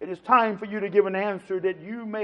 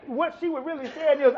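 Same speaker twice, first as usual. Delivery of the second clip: usual speaking voice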